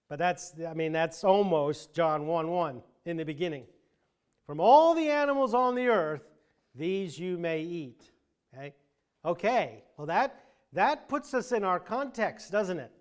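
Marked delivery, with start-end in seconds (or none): 4.5-8.1 From all the animals called out louder and higher, these you may eat slowed; emphatic stress. Shown as a demonstration. Teacher driving home the echo to the class, stressing all and these. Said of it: "I'm gonna put some emphasis on the words".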